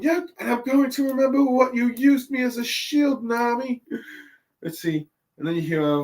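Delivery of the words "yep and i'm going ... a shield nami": much higher resentful promise; grudging Usopp. Retold or imitated. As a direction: hold a grudge in a cartoon voice. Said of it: "And saying".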